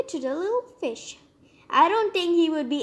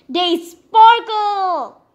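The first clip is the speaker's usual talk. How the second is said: much louder, slow and drawn out; awed exclamation; 4 semitones higher, 10 dB louder, much slower than usual. A narrator's reading. Narrator marvelling at the fish to young listeners, loud slow awe. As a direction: let the wonder burst out.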